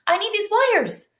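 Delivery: very high, insistent protest; emphatic storybook exclamation; exclaiming. A narrator's reading. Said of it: "he exclaimed".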